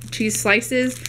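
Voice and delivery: usual speaking voice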